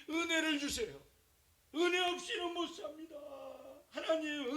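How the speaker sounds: high, loud pleading cry to God; desperate prayer. Acted. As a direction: beg God as if life depends on it. Hannah's desperate prayer acted out.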